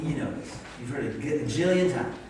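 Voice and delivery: ordinary talk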